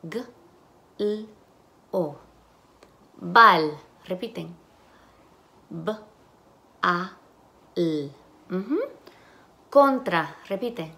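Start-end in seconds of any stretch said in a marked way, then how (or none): 0.0-2.2 "g, l, o" as isolated sounds with gaps. Shown as a demonstration. Teacher asks for the phonemes, sound by sound. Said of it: "Las fonemas". 5.7-8.1 "B, a, l" as isolated sounds with gaps. Shown as a demonstration. Teacher segments bal into phonemes, sound by sound. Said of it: "Las fonemas".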